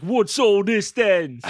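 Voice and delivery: loud, high, drawn-out Cockney outcry; hammed-up Cockney accent, slowed right down; mock Cockney. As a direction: bellow it as a cartoon Cockney. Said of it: "like, Cockney for some reason".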